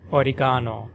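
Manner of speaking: oregano as "o-ri-GAH-no", ga stressed; British oregano, GA stressed. Shown as a demonstration. Teacher contrasting oregano in British English, ga stressed. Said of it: "in British English".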